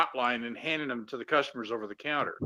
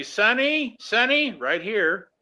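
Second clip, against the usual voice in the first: calling out; 6 semitones higher, slower than usual. Retold or imitated. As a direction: call the name out to the counter. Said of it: "manager would come up and say".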